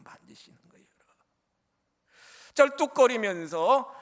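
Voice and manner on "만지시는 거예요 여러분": hushed whispered repeat of 만지시는 거예요; a soft, awed whisper. Shown as a demonstration.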